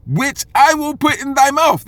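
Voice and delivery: high, loud and slow, like a prophet's decree; lifted, booming scripture line; proclaiming scripture. Acted. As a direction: ring it out as the word of God.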